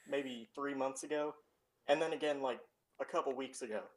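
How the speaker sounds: ordinary delivery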